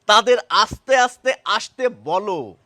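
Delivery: loud, slow, আস্তে with s then আসতে with sh; slow contrast sentence. Shown as a demonstration. Teacher contrasts both words in one sentence, loud and slow. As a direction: carefully separate আস্তে আস্তে from আসতে. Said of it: "দুটি শব্দ একটি বাক্যে".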